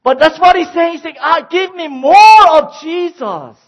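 high, loud, slow impassioned preaching; fiery preaching. Real, not acted. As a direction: cry it out to the church. Preaching at full force.

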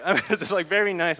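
bashful chuckle at being praised; flustered laugh at his friend's flattery; embarrassed laugh. Real, not acted.

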